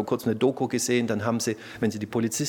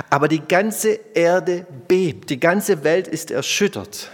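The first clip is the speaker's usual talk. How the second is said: slow and louder, weighty; dramatic proclamation; 5 semitones higher, 5 dB louder, slower than usual. Acted. Preacher telling the earthquake to the congregation, slow and loud. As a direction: let the earth shake in each slow word.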